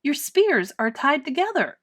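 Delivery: put-on boy taunting the guards loudly; shouting. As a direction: call it out loud and cheeky. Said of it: "shouted Eric".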